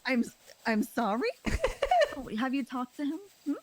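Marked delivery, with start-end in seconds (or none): 1.3-2.3 mocking laugh.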